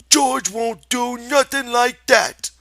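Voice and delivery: high, loud, slow defiant insistence; belligerent. Acted. Lenny defends George, loud and stubborn. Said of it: "retorted belligerently".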